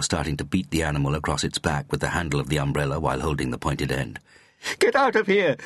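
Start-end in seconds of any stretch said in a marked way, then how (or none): 4.8-5.6 loud, very high scream. A narrator's reading. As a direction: scream it to drive the intruder out. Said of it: "she screamed".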